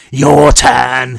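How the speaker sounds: loud, gloating monster voice with a full mouth; monster's taunt. Acted. The monster Patrick taunting Marlowe with a loud gloating growl. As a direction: sneer it through half-chewed food. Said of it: "he said, spraying him with pieces".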